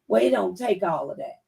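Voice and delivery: ordinary delivery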